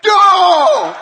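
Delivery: loud triumphant yell; a fired-up group roaring yes; crowd shout. Retold or imitated. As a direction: shout it back like an eager crowd. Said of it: "Gritaron los míos".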